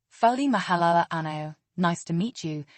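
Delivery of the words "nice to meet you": ordinary talk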